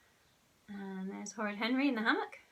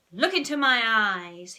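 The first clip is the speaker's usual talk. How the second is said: ordering; 3 semitones higher, 10 dB louder than usual. A narrator's reading. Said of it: "he ordered".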